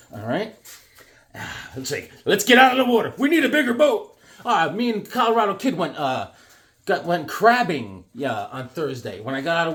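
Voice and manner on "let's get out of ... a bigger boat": higher, louder mock panic from the shark movie; movie quote. Showing his shark drawing, a mock panicked movie quote. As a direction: yelp it like a scared sailor on a boat.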